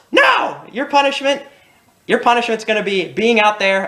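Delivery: high, shrill-pitched mock scold easing down; hammed-up angry mom handing out punishment.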